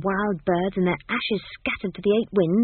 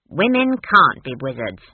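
loud, low, flat-out refusal; blunt; 4 semitones lower, 6 dB louder than usual. A narrator's reading. Granny rules out wizardry for Esk, blunt. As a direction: lay down the rule bluntly. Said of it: "said Granny bluntly".

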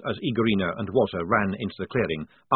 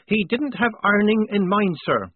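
high, louder earnest insistence; fervent; 11 semitones higher, 5 dB louder than usual. A narrator's reading. Polly defending herself to the lieutenant, fervently. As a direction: press the point with fervour. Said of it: "said Polly fervently".

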